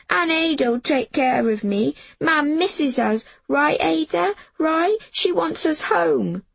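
put-on high child's voice, begging; pleading little boy. Jamie pleading with Ada that Mam wants them home. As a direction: keep pleading, seeking his sister's support. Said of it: "Jamie said".

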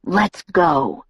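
mildly hard, low command. A narrator's reading. Edward orders Bella to leave, low and hard. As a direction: give the order firmly, held down. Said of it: "Edward said in a low, hard voice".

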